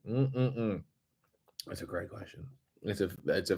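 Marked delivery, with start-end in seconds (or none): none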